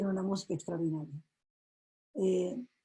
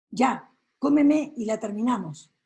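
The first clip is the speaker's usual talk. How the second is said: defiant dare; 3 semitones higher, 8 dB louder than usual. Acted. Girl dares the wolf to eat her.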